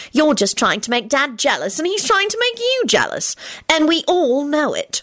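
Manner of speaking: high-pitched smug lecture; scold, then finish self-satisfied; high, knowing reproof ending smugly; tart, then satisfied. A narrator's reading. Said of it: "finished in a satisfied voice".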